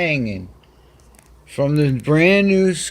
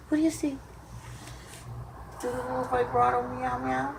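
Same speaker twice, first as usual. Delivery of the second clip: high, sing-song cooing to a pet; pet talk; 13 semitones higher, 10 dB quieter than usual. Real, not acted. Talking to her cat Patches, high sing-song cooing. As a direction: coo sweetly up high at the cat.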